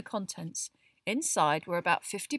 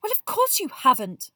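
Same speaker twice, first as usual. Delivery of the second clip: brisk retort, higher and louder; brisk Hermione; 9 semitones higher, 10 dB louder than usual. A narrator's reading. Girl answering a friend who never heard of it, brisk. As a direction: answer briskly, pitched well up. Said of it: "said Hermione briskly".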